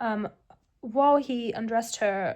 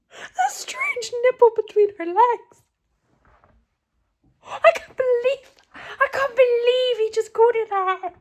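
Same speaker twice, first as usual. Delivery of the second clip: shocked laughing outrage; 12 semitones higher, 5 dB louder than usual. Real, not acted. Reacting to absurd text.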